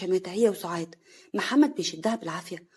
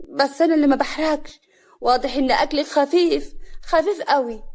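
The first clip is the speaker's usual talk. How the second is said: loud, high, indignant quarrel; heated, raised-voice insults; angry; 5 semitones higher, 6 dB louder than usual. A narrator's reading. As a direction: hit back furiously at your rival. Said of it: "كريمة بغضب".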